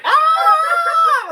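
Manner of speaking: very high, very loud shriek; piercing battle shriek; shrieking war cry. Retold or imitated. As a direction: scream like charging into a fight. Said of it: "and I was like".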